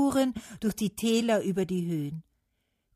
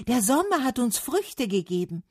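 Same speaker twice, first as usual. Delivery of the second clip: higher, raised call; 7 semitones higher, 4 dB louder than usual. A narrator's reading. Trees praising Summer's fruit, calling out. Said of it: "riefen die Bäume".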